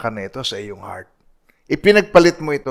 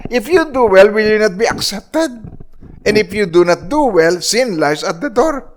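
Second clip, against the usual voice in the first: preaching at full force; 8 semitones higher, 3 dB louder than usual. Acted. Verse quoted again at full force. (mataas at mabagal na pangangaral)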